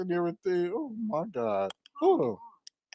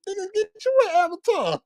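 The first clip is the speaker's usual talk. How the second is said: mock exasperated retort; 12 semitones higher than usual. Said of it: "I'm like".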